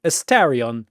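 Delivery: ordinary delivery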